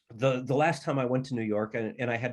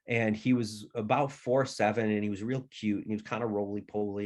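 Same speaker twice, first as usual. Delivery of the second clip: ordinary talk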